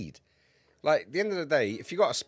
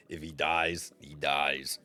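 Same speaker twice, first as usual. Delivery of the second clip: put-on low-pitched Russian accent; 8 semitones lower, much slower than usual. Streamer quoting a line he pictured in a Russian accent, Russian-accented. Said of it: "pictured that in a Russian accent".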